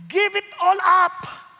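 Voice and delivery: loud, slow, drawn-out tempter; tempting evil voice. Acted. Preacher voicing the evil advisor who urges despair, loud and slow. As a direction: voice the evil advisor luring him to quit. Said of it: "Tingnan niyo na".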